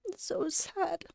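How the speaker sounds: soft, tight, near tears; real quiet grief; choked-up sadness. Real, not acted. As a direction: let it catch in the throat. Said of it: "I cried at the conference too".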